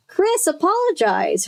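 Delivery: high, stern command; a father ordering his daughter to apologize, pitched high; angry demand. A narrator's reading. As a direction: demand it with anger. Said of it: "her father demanded angrily".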